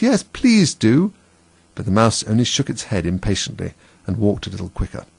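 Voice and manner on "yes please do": very high, drawn-out plea; stretched, eager entreaty; chorus of pleading. A narrator's reading. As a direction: beg slowly in a high voice. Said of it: "all joined in the chorus".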